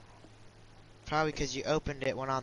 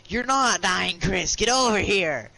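raised, louder real protest; insistent calling; 6 semitones higher, 5 dB louder, slower than usual. Real, not acted. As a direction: call your teammate over, high and loud. Urgently calling teammate in game.

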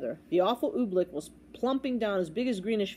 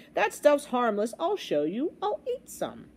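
cocky captain; 4 semitones higher than usual. Acted. Captain shows off to the boy, cocky. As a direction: announce it with breezy bravado. Captain cockily offers to eat oobleck.

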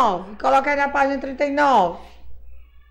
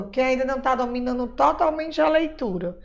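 ordinary delivery

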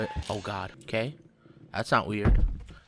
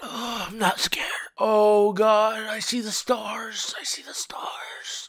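reeling in distress; 7 semitones higher, slower than usual. Real, not acted. Player seeing stars after a disgusting bite, reeling in distress. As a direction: whimper it slowly as if about to pass out.

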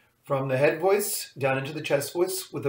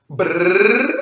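louder lip trill, rising; loud lip buzz; 3 semitones higher, 7 dB louder than usual. Shown as a demonstration. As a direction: buzz the lips harder. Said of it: "I can do it again".

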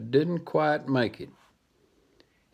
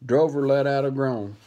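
ordinary delivery, nothing marked

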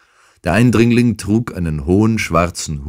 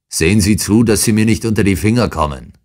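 louder, higher and fast; shouted threat; 6 semitones higher, 5 dB louder, faster than usual. Acted. Intruder threatening Holmes on leaving, shouting. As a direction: yell the warning as he storms off. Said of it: "schrie er Holmes noch zu".

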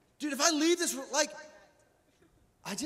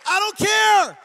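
full-voice shout, much higher and louder; 11 semitones higher, 11 dB louder, slower than usual. Real, not acted. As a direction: yell it out with defiance. Preacher shouting defiance.

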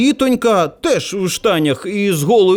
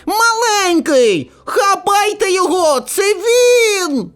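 put-on high, drawn-out child's cry; boy shouting; 10 semitones higher, slower than usual. A narrator's reading. Boy in glasses sets adults on the narrator, shouting. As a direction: call out high like an accusing little boy. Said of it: "хлопчик в окулярах кричить".

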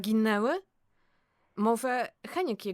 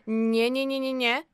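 rapid louder string of no's; quick loud refusal; 2 semitones higher, 7 dB louder than usual. Acted. Krystyna refuses to stay late at work, loud. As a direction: brush off the request briskly and loudly.

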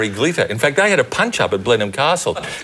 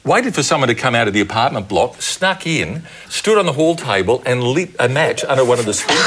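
ordinary delivery

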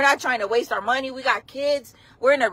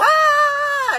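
high loud panic yell; panicked scream; 4 dB louder than usual. Retold or imitated. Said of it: "and go".

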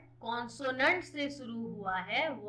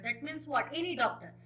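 usual speaking voice

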